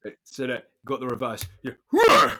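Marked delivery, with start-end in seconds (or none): 1.6-2.3 loud high effort grunt; tennis grunt. Shown as a demonstration. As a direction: grunt like hitting a serve. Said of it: "your best tennis grunt impression".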